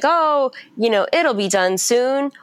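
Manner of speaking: high, sing-song mock of empty comfort; mocking cheery platitude. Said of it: "Everybody's like".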